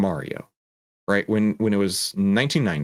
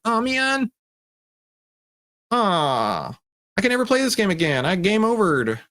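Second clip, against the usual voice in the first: high, whiny mock wail; hammed-up disappointment in a child's voice; dejected kid; 10 semitones higher than usual. Kid knocked out by turtles in Mario, crushed whine. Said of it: "and you're like".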